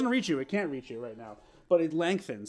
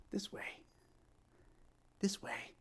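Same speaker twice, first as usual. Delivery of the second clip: a creepy whisper luring them closer; eerie whisper; 3 semitones higher, 14 dB quieter than usual. Acted. Stone calling to the player.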